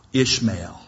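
usual speaking voice

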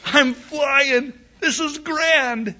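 high, loud, gleeful; exhilarated. Acted. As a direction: ride the thrill of first flight. Eaglet flaps then soars in joy.

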